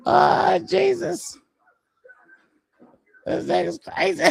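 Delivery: high shocked yelling; shocked shout. Real, not acted. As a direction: yell it in disbelief. Shocked reaction to gory scene.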